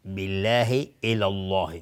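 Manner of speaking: light L in بالله, then dark L in الله, slowly; thin then heavy L. Shown as a demonstration. Teacher contrasting both rules, thin then heavy L. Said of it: "دقت کنید".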